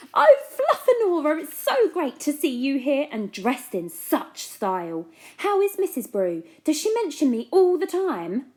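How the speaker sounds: high, loud gushing excitement; gushing fan. Acted. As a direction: fawn over her breathlessly. Fran gushing over Fluffanora.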